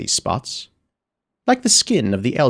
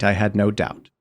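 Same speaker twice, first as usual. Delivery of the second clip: ordinary delivery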